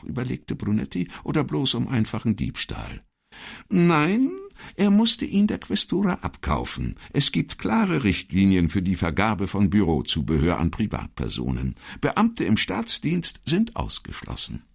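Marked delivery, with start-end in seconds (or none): 3.7-14.6 high-pitched, emphatic secretary voice; insistent secretary. Acted. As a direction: set the record straight in a high voice. Voices Elettra in dialogue.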